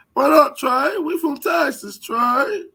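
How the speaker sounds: high, loud crybaby greeting; whiny rival impression. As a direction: greet the guest in the mock-tearful voice. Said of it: "That's why he sound like that".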